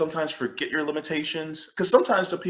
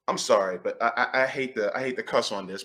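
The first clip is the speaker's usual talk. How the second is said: ordinary talk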